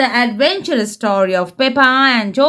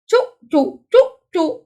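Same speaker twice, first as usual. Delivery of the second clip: put-on higher, playful toddler choo-choo; George crying choo-choo; 6 semitones higher, faster than usual. Little George calling out on the train, higher playful choo-choo. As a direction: chant it like an excited little boy. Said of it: "cries George".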